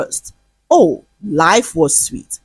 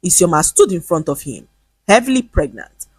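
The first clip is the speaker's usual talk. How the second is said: ordinary delivery, nothing marked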